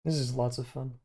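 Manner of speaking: flat deadpan monotone. Real, not acted. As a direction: say it with zero enthusiasm. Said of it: "He says in a completely monotone voice".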